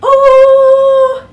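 very high, loud delighted ooh. Real, not acted. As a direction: squeal it with delight. Reaction while showing.

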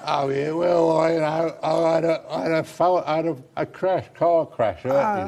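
telling of the car crash in a mock English accent; put-on English accent. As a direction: laugh through a hammed-up English accent. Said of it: "I started talking like that".

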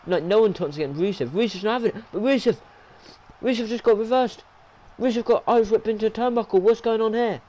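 loud, urgent match commentary; worked-up live wrestling commentary; excited play-by-play. Real, not acted. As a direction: call the upset as it unfolds, loudly.

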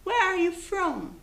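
"Where are you from?" slow, high, falling on from; slow classroom model phrase; teacher model. Shown as a demonstration. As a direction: drill "Where are you from?" slowly for repetition. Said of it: "Vamos tentar repetir comigo? Olha lá".

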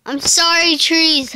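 high, loud hammed-up sorry; mock apology. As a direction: wail a fake apology to the trees. Apologizing to chopped trees.

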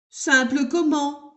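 grumble, fed up with the machine; grumbling. Acted. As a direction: handyman grumbling at the children. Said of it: "rouspéta Monsieur Bricoleur".

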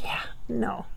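a soft unvoiced aside; whispered aside. Real, not acted. Medium murmuring her reply to George, whispered. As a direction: breathe it as a private whisper.

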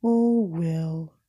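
gloomy drawn-out moan; moaning Eeyore. Acted. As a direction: sigh it out like a glum old donkey. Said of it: "moaned Eeyore".

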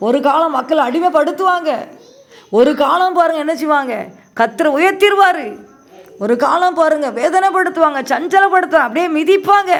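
loud, rising preacher's cry; fiery preaching. Acted. Preacher warning the congregation, loud and rising. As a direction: preach it out, building to a peak. Preaching builds to full force.

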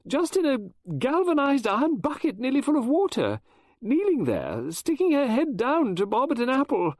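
very high, loud and overwrought; near hysterical. Acted. Mrs Oliver telling Poirot she hates apples, near hysterical. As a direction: spill it out close to panic. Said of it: "I was getting hysterical".

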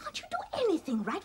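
a fast, hissed telling-off; fast whispered reproach; scolding whisper. Acted. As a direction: scold him under your breath. Scolding the boy under her breath.

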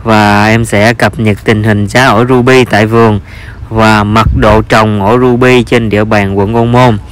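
ordinary delivery

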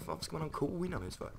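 high, fast put-on voice of confusion; mock bewildered whine; baffled self. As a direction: squeak out the question in disbelief. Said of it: "Man bara".

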